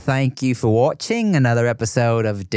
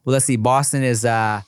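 ordinary talk

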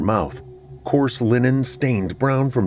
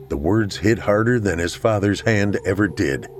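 ordinary delivery, nothing marked

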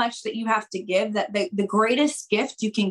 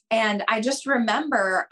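normal talking voice

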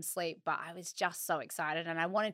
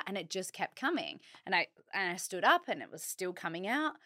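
ordinary delivery

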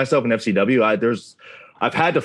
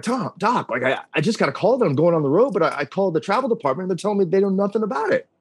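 high, rushing towards the end; retold anxious appeal; 7 semitones higher, faster than usual. Trainee phoning his head trainer about the mix-up, flustered appeal. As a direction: relive a flustered call for help, pitched up.

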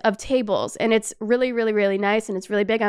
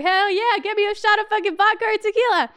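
mock-hyped high party order; excited; 10 semitones higher than usual. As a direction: whoop it up for shots.

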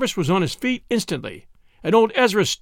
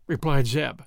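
ordinary delivery, nothing marked

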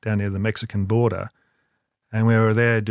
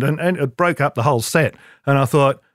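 ordinary delivery

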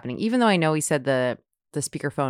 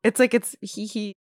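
high hee hee held in; stifled giggle; 5 semitones higher than usual. Shown as a demonstration. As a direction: giggle through closed lips. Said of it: "but my mouth's closed".

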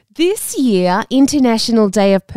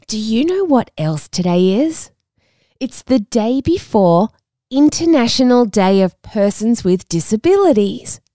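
ordinary talk

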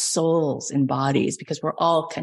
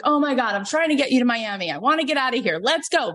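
higher, exasperated pleading in the car; frustrated; 7 semitones higher, faster than usual. Retold or imitated. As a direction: vent at him, fed up and pushing. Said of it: "And so I get so frustrated".

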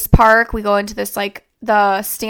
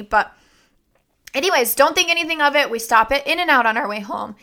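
ordinary talk, nothing marked

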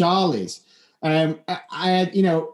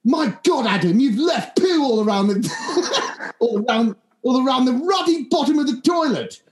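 exasperated posh friend, shouting; 7 semitones higher than usual. Retold or imitated. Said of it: "got a posh voice".

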